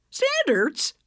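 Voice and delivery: a shocked, indignant echo; incredulous repeat. Acted. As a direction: throw the word back at her, scandalised. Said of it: "Edna repeated, glaring at the laundry basket".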